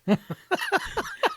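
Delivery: very high burst of real laughter; punchline laugh. Real, not acted. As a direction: crack up at the punchline.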